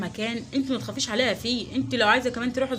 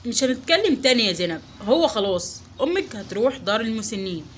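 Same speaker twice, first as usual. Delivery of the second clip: firm, louder, final verdict; stern decision; 5 semitones lower, 4 dB louder than usual. Acted. Husband telling his wife her mother must go, stern. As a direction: shut the argument down hard.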